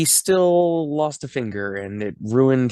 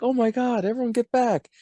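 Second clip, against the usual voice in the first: mock panic; 11 semitones higher than usual. Guests see the boat coming.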